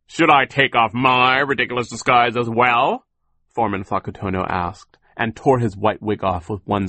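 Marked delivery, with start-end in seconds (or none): 0.1-3.1 loud taunt lifting at the end; mocking question. A narrator's reading. Foreman offers to take off his own disguise, loud and mocking. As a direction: toss the order back, raised and mocking. Accomplice taunts while unmasking himself.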